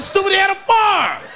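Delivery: answering Leon's question, a mock yell; exasperated shout. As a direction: yell it at the dumb kid.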